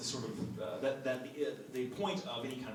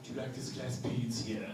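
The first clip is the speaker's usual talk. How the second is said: British speech therapist; 3 semitones lower than usual. Retold or imitated. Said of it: "that very neutral British, that very authoritative".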